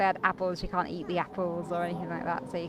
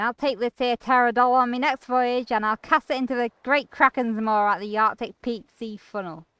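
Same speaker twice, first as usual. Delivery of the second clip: sea captain; 4 semitones higher, 8 dB louder than usual. Acted. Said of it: "said the sea captain".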